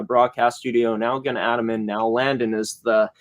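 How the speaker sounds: ordinary delivery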